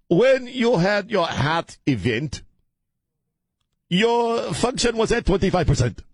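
put-on very high, slow African-accented doctor; mock African accent. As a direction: imitate a cardiologist pronouncing results in an African accent. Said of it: "do a very racist accent".

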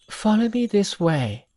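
put-on high voice of a fairy boy. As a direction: play a bright young boy.

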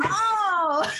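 a high, startled "oh" that breaks into laughter; sheepish high laugh; embarrassed laugh. Real, not acted. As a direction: laugh at yourself, caught out. Caught out, laughs at herself.

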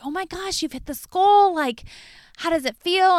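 high, bright-eyed congratulations; cheering him on, voice way up; excited. Retold or imitated. Celebrating husband's goal, quoted.

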